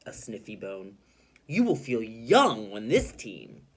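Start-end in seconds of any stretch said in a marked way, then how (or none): 1.5-3.6 slow, louder, encouraging; reassuring. Shown as a demonstration. Teacher voicing Missus Teaberry comforting Mister Potter. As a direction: make him feel better. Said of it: "trying to make him feel better".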